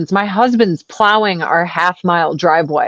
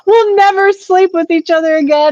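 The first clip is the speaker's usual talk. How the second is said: higher, hammed-up solemn decree; mock solemn warning; 5 semitones higher than usual. Wife warns husband off with mock grandeur. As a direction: proclaim it like a prophecy, half joking.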